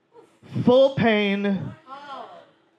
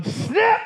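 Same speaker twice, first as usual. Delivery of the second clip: sudden high yelp of pain. Retold or imitated. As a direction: yelp the word as it hurts. The painful snip.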